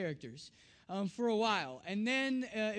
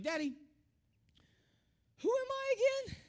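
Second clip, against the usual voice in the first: imitated little girl. Daughter rolls over at bedtime, high little-girl voice. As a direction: pitch it way up like a small daughter asking Daddy.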